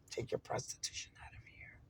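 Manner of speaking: barely voiced, under the breath; whispered repeat. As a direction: whisper the quoted insult. Repeats the insult in disbelief.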